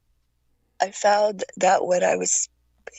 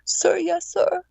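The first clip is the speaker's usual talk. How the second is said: timid recruit; 11 semitones higher, slower than usual. Retold or imitated. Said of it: "I said".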